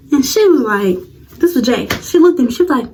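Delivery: ordinary talk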